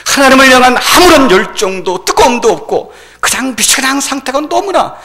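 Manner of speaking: high, loud sermon delivery; impassioned preaching. Acted. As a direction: press each phrase out forcefully. Preaching at full force. (열정적으로 외치는 설교)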